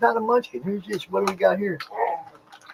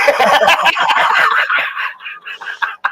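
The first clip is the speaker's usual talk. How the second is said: airy high laugh; breathy laugh; 9 semitones higher than usual. Real, not acted. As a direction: laugh out at the joke. Laughing at friend's remark.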